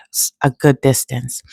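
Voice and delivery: ordinary talk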